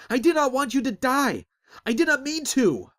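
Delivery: high, loud anguished wail. Acted. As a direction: plead in despair, crying out. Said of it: "Azric wailed".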